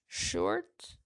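cracking, hoarse voice. Real, not acted. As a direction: voice breaking on the word. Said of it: "It's cracking".

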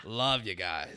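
loud, low mock Aussie; Australian accent attempt. Host reading out a fan's message, mock Australian accent. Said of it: "That's my best attempt at an accent".

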